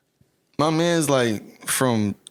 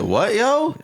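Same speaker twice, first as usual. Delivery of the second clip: high, rising, baffled "what, yo?"; baffled reaction; 11 semitones higher than usual. Retold or imitated. Retelling his reply to friend's slang, baffled. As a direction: throw it up high in disbelief. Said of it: "I said".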